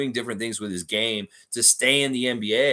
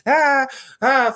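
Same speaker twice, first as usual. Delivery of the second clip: mock laugh; 10 dB louder than usual. Fake laugh at a chat joke.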